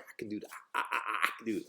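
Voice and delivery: soft, stumbling repeats of "I"; nervous stuttering. Retold or imitated. Young applicant stuttering through his pitch to the interviewer. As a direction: trip over the first word. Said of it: "I was stuttering and shit".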